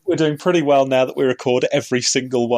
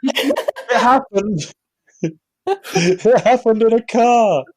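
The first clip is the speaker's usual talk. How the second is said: slow, laughing through it; drawn out between giggles; 8 semitones higher, slower than usual. Real, not acted. Group laughing at the story.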